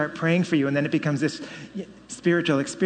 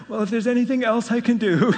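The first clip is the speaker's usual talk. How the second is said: higher, laughing through a gracious offer; laughing mock generosity; 8 semitones higher, 5 dB louder than usual. To the theologians who doubted him, mock gracious, laughing. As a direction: tease the apologizing theologians, high and giggling.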